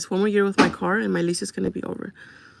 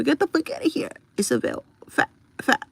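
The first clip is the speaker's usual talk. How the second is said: much higher, mincing mock quote of the comment; mocking readout; 6 semitones higher than usual. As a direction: parrot the insult back to ridicule it. Reading a hater's comment aloud.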